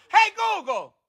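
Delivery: high, insistent falling call; interrupting the assistant. Real, not acted.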